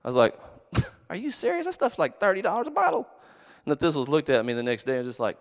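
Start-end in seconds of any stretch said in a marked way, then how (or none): 1.1-3.1 high-pitched disbelief; retelling his own disbelief, pitched way up; incredulous. Retold or imitated. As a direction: squeak it out, shocked at the price. Retold shock at the price.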